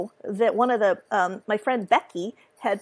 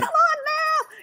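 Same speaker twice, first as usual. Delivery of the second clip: very high, mock-exasperated squeak; high mock ghost plea; slower than usual. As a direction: squeak it like an impatient spirit. Voicing spirits, exasperated.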